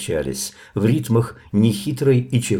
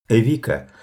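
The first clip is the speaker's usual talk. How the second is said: ordinary delivery, nothing marked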